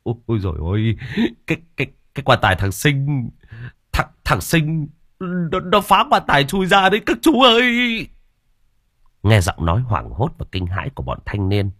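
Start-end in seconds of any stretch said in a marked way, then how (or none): 0.0-8.1 stammering, rising into a high terrified cry; young villagers stammering news of the corpse, panicked. Acted. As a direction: blurt it out in panic, tripping over words. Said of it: "giọng hốt hoảng".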